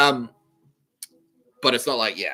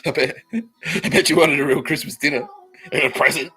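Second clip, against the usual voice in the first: louder, gleeful teasing; mock gloating taunt; 5 semitones higher, 7 dB louder than usual. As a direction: rub it in that he gets no Christmas. Gloating at a jailed man.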